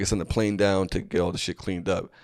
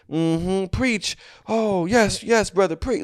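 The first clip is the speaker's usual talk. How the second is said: high, fervent churchgoers egging on a preacher; high mocking amen-corner voice; mock congregation; 11 semitones higher, much slower than usual. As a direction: chime in like a swept-up congregant. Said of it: "they're, like, agreeing with them".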